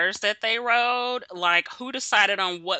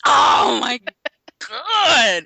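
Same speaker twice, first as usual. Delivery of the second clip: loud, high, drawn-out exasperation; real appalled groaning shout; exasperated outcry; 4 semitones higher, 7 dB louder, much slower than usual. Real, not acted. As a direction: wail it in disbelief. Exasperated by co-host's prediction.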